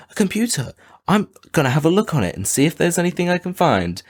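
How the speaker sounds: put-on high-pitched nosy kid; acted boyish wonder; mock curiosity. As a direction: pitch it way up, eager to snoop. Voicing kid discovering a computer.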